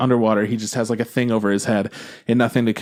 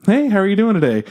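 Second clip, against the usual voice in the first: much higher-pitched, perky customer-service hello; chirpy cashier greeting; 10 semitones higher, 4 dB louder than usual. Retold or imitated. Cashier routinely greeting a customer at the register, chirpy. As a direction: put on a cheery cashier's greeting.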